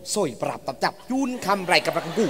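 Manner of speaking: low, soft mumbling; mumbled patter. Acted. Mock examiner checking the pregnancy, low mumbling. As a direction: mumble it low under the breath.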